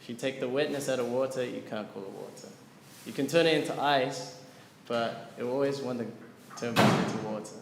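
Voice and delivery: usual speaking voice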